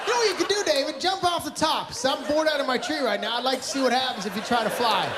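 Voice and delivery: hammed-up fed-up dad; mock-taunting shout. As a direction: yell it up the slide like a bored villain. Dad mock-taunting kid at park.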